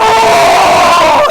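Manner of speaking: sudden high full-voiced scream. Tuco screaming at his steering wheel, high loud yell. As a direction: let out a raw yell at the wheel. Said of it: "he just screams".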